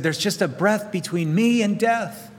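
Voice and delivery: high, anxious lament; fearful. Pretend. David fleeing Saul, fearful. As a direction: sound hunted and near death. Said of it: "David said".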